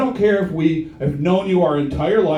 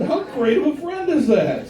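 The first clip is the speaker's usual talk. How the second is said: usual speaking voice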